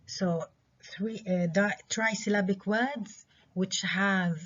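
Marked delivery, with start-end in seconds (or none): none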